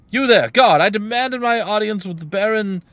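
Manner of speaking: loud, high call to a guard; indignant cleric. Acted. Cleric hailing a jail guard for an audience, indignant shout. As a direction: demand an audience, projecting loudly.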